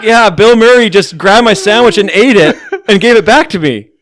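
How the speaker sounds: loud and high, laughing through the words; giggling as you imagine telling it; laughing retelling. Retold or imitated. A friend retells the sandwich story, laughing loudly. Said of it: "It's like".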